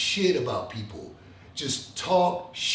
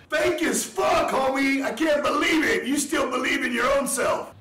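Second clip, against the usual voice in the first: angry shout; 8 semitones higher than usual. Real, not acted. Angry outburst at fake host.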